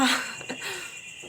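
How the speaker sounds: a short airy laugh; light breathy chuckle; breathy giggle. Real, not acted. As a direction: giggle under her breath at the dud. Firecracker fails to light.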